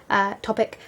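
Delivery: ordinary talk, nothing marked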